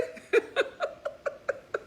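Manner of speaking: airy laugh, no voice; breathy high laugh. Real, not acted. Laughing at her own point to viewers, breathy.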